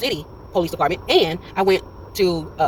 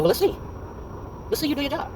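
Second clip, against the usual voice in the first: taunting; 8 semitones higher, 7 dB quieter than usual. Retold or imitated. Daring the cop to act.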